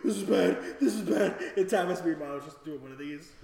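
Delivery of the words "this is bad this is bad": gasping, tearful fretting; hyperventilate, then fret louder; mock crying panic. Storyteller crying beside his girlfriend, gasping mock panic. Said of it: "never seen me cry like this".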